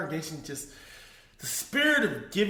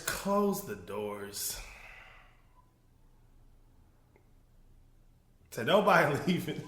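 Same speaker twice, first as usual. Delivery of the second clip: mock preacher command; 4 semitones lower than usual. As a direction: drag it out like the pastor. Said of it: "He tells the ushers".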